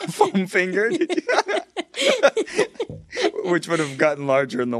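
high laughing question. Real, not acted. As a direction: giggle through the question. Laughing disbelief.

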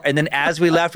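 normal talking voice

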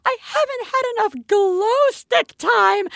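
mother imitating her daughter's high whine; whiny little girl. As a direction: play the heartbroken kid begging to keep playing. Parent voices daughter's tantrum.

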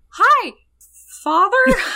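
put-on high, loud childish greeting; hammed-up son greeting his father; mock sweet son. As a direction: squeak out a fake sweet hello to dad. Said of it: "he's just like".

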